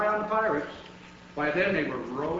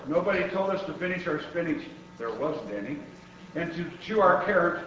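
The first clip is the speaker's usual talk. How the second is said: ordinary delivery, nothing marked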